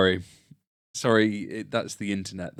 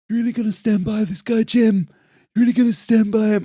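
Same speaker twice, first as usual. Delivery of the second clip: high, loud, breathy police chief impression; 13 semitones higher, 11 dB louder than usual. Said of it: "he's got a real breathy voice".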